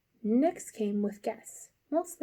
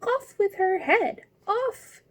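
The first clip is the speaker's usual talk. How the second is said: high, loud, slow scream; 11 semitones higher, 4 dB louder, slower than usual. A narrator's reading. Furious queen screaming for Alice's head. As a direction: shriek the order at full pitch. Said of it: "began screaming".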